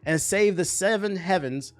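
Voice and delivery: trailer-style read, key words stretched; dramatic synopsis read. Real, not acted. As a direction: read it like an epic series teaser, voice lifted. Reads the show synopsis dramatically.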